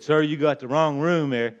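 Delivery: put-on firm old lady voice telling him off; aunt's scolding. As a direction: scold the stranger like a feisty old aunt. Aunt scolding intruder in story.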